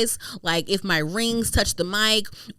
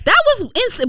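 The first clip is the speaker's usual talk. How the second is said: much higher, louder exclamation; exclaiming; 8 dB louder than usual. Real, not acted. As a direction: still worked up.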